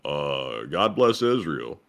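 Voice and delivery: slow, lightly put-on politician voice; mock former official. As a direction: lightly imitate a halting official, drawing it out. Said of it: "U S official that says".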